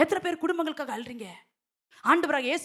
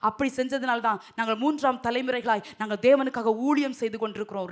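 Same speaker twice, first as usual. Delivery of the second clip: usual speaking voice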